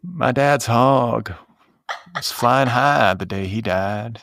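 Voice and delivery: slow Texas drawl; Texas accent. Pretend. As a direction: drag it out like a Texan. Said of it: "Got your Texas accent down".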